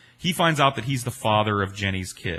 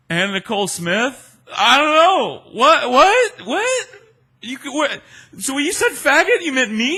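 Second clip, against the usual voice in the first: high, loud, incredulous stammer; baffled disbelief; 9 dB louder than usual. Retold or imitated. He is stunned that the celebrity is insulting him, incredulous. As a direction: sputter the questions in total disbelief. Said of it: "And I was like".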